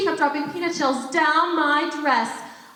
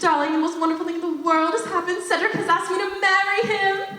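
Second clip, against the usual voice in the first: bright excited news; 3 semitones higher than usual. Acted. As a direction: tell the news gladly but held back. Repeats the news to Maggie.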